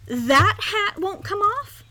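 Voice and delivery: put-on high, slow, scornful boy; mean scorn. A narrator's reading. Young duke looking at the stubborn hat, mean scorn. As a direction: sneer it slowly, high and incredulous. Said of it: "looking at Bartholomew's hat and laughing meanly".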